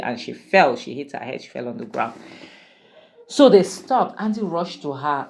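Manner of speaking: normal talking voice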